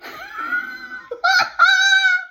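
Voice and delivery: loud high cackle; burst of laughter. Real, not acted. Reader cracking up at the story, loud laugh.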